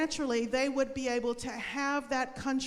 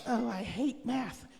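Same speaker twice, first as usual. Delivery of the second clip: reluctant whine; 3 semitones lower than usual. Retold or imitated. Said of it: "not go".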